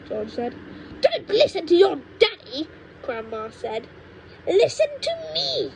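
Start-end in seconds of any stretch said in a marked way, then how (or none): 1.0-2.7 put-on high, cranky old woman lecturing; cranky grandma. Grandma scolds George about growing, in a high cranky voice. 4.5-5.7 put-on high, cranky old woman lecturing; cranky grandma. Grandma scolds George about growing, in a high cranky voice.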